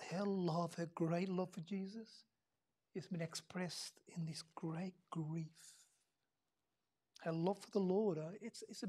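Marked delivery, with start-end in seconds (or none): 0.1-5.5 slow, whispered and much softer; grieving hush. Real, not acted. Preacher dwelling on Mary's grief for Jesus, whispered. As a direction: let the grief sink to a whisper.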